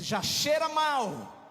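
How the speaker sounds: high, drawn-out objection; loud protest. Acted. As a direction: cry out the complaint, stretching it slowly. Martha protesting, voice raised and drawn out.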